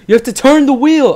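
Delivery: exasperated retold command, loud and high; insistent shout. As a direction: insist loudly, like a fed-up instructor. Dad's order in retold quarrel.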